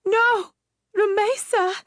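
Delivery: very high, loud wail; anguished cry. Acted. Mother cries out as the witch leaves with the baby. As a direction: wail her name in despair.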